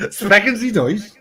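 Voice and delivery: laughing, high put-on German; mock German question through laughter. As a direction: ask it in a jokey German accent. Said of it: "really thick German accent".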